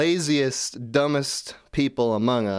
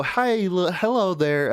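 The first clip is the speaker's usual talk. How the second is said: higher-pitched, put-on polite greeting; mock clueless user; 8 semitones higher, slower than usual. User greeting the AI tool, mock-naive. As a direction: play a naive user addressing a gadget. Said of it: "I go".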